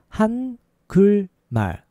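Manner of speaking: three drawn-out, separate syllables; slow demonstration. Shown as a demonstration. Teacher showing learners the word for Korean, slow. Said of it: "Faster is".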